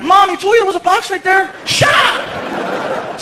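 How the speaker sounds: put-on high exasperated boy; high mocking kid. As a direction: scold mom like a fed-up kid. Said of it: "Even Frankie was like".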